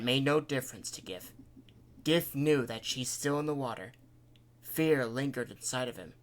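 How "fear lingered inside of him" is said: ordinary delivery, nothing marked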